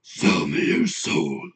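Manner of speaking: low, slow possessed demon growl; slow menacing demon voice. Pretend. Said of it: "I could try to do a demon voice".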